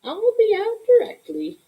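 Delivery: grandma's voice. Acted. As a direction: promise lightly, like a grandmother. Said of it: "she said".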